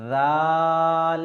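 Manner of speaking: জাল drawn out very long, tongue between the teeth, no hiss; Arabic letter Dhal. Shown as a demonstration. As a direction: stretch জাল slowly like a Quran teacher. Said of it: "কোনো সিটির আওয়াজ বার হবে না".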